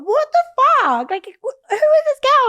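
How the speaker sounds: high, loud outburst of outrage; re-enacted car park scream; retold screaming. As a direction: yell it in disbelief, drawing out the first words. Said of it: "I was just screaming in the car park".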